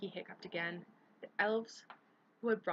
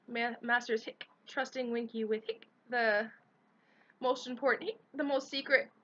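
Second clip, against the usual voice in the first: higher, louder, building hiccuping elf; tipsy pride swelling up; drunk elf; 5 semitones higher, 6 dB louder than usual. A narrator's reading. Drunk elf boasting of secrets.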